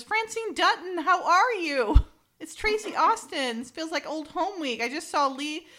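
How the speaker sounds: gushing tennis star. Retold or imitated. Tennis star greeting an old friend, gushing high.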